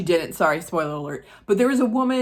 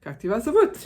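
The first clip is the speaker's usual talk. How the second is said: mock questioner; 7 semitones higher, 5 dB louder than usual. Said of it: "they asked her".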